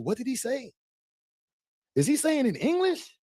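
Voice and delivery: confused voice. Pretend. Mocking confused listener.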